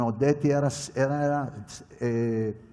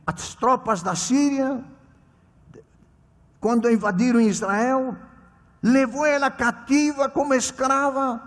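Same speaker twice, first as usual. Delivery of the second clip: raised, earnest sermon voice; preaching; 8 semitones higher, 4 dB louder than usual. Acted. Pastor exhorting the congregation about testimony, earnest preaching. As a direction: urge the congregation warmly but firmly. Sermon retelling Bible story.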